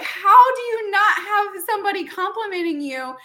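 high, loud disbelief with a laugh; can't believe nobody compliments her; laughing, high-pitched incredulity; incredulous. Retold or imitated. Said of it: "I was like".

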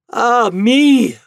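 high, loud lament; theatrical high-pitched cry of woe; anguished cry. Acted. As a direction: wail in despair. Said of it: "cried the wife".

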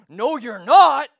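defiant objection. Retold or imitated. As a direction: forbid it forcefully, imitating an angry worker. Retold standoff over kicking the door.

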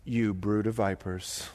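low, slow fiery prophet line; John the Baptist. Acted. Preacher mimicking John the Baptist rebuking crowd, low and slow. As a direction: thunder it at them like a wilderness prophet.

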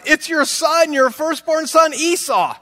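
high-pitched, insistent son; eager reply. Acted. Esau telling his father who he is, high and eager. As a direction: answer high and keen to be recognized.

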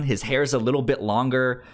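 usual speaking voice

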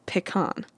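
"pecan" said pih-KAHN; short first vowel in "pecan", then kahn; pronunciation pih-KAHN. Shown as a demonstration. Vlogger listing options, pecan as pih-KAHN. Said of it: "Do you say".

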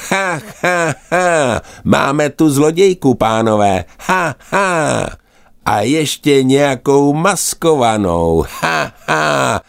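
high, cackling gull voices; jeering gulls. Acted. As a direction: squawk it out like a mocking gull. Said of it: "křičeli racci jeden přes druhého". (vysoký posměšný racčí chechot)

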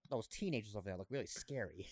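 low, soft mock-proper aside. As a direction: drop it low and quiet, mock-wary.

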